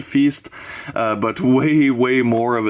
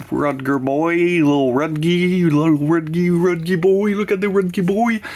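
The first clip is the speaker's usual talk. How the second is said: higher, cooing over a cute pet; cooing baby talk; 6 semitones higher than usual. Pretend. Doting on a VHS cover, cooing baby talk. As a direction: coo the nickname like baby talk.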